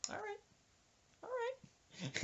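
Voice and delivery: very soft, high falsetto; tiny ominous high voice; seething old woman. Retold or imitated. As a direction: imitate her holding it in, hushed and high. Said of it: "she said".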